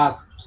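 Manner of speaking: Asked said with s before k, ending in ask-t; correct pronunciation. Shown as a demonstration. Teacher modelling the word Asked for the student, s before k. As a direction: say Asked as a-s-k-t, the s sound first. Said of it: "A কে এস E D নয়, A এস কে E D তো".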